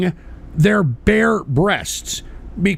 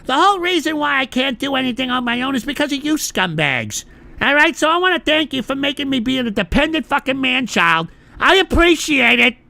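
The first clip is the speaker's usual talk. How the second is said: mock sarcastic thanks; 5 semitones higher than usual. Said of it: "and say".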